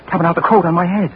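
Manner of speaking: ordinary delivery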